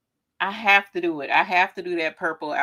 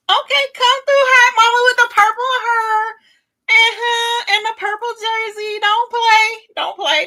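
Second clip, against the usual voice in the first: hype; 8 dB louder than usual. Real, not acted. Hyping herself up in jersey.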